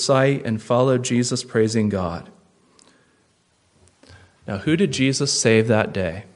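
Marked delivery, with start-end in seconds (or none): none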